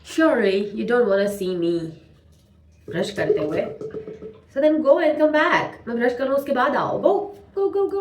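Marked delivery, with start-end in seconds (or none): 4.5-8.0 playful shooing. Pretend. Shooing viewers away while brushing.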